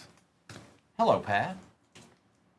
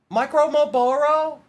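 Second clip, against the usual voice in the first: much higher, mock little-kid squeak; four-year-old voice; 13 semitones higher, 5 dB louder than usual. Card breaker mocks a player nickname in a four-year-old's voice. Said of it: "it sounded like a four-year-old said it".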